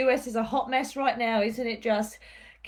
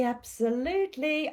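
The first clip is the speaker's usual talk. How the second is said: ordinary talk, nothing marked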